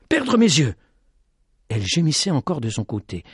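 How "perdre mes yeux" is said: frightened. A narrator's reading. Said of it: "se disait-il".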